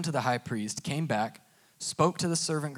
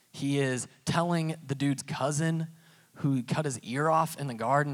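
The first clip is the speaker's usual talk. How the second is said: usual speaking voice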